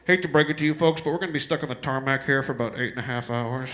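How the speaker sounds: low, lazy captain announcement; pilot voice. Retold or imitated. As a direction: deliver it like a bored pilot. Pilot announcing tarmac delay.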